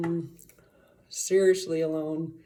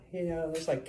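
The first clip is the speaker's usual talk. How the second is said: ordinary talk, nothing marked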